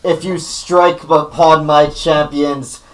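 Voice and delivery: slow, loud proclamation; booming divine voice. Acted. Cleric faking a god's warning, booming. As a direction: boom it out slowly like a god. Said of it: "like a very booming voice say".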